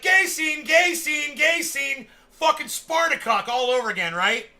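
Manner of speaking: loud, high, indignant yelling; outraged shouting. Retold or imitated. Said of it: "I was like".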